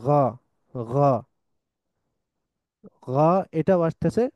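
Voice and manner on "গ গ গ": গ gargled from the throat like Arabic ghain; ghain demo. Shown as a demonstration. Teacher showing students the Arabic ghain sound. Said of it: "কিন্তু বাংলায় গ নাই".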